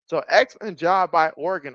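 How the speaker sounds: normal talking voice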